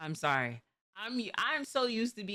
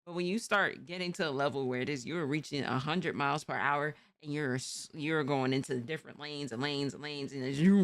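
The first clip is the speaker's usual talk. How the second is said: usual speaking voice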